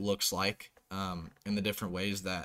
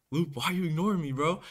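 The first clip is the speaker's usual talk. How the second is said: higher, fast put-out teammate; lightly voiced whiny teammate; teammate whining; 7 semitones higher, 3 dB louder, faster than usual. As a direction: needle him like a hurt buddy. Teammate quoted asking.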